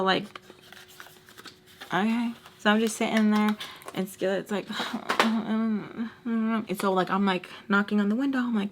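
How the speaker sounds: usual speaking voice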